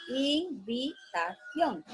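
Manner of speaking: Invitación split into its four syllables, very slow and soft; syllabified slowly. Shown as a demonstration. Teacher showing Invitación has four syllables. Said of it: "Esta tiene cuatro sílabas".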